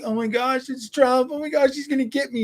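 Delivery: mock scared liberal. Streamer mocking critics crying in a closet, high panicky mock voice. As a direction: squeal it like a frightened kid. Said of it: "being like".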